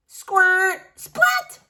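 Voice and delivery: high, loud comic sound effects; goofy squirt then splat. Acted. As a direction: splash them out like a cartoon.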